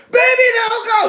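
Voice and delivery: hammed-up clingy plea; begging. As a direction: beg him not to leave. Delilah begging him to stay.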